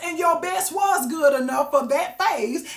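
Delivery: normal talking voice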